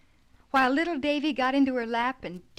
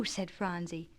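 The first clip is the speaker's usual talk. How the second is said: plain reading voice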